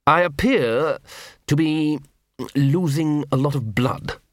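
lightly voiced wounded lord, drawn out; wounded Lord Vetinari. A narrator's reading.

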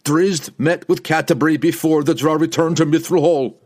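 loud, high-pitched accusing outburst; heated accusation. A narrator's reading. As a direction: hurl the charge in front of everyone. Barbarian makes loud accusation.

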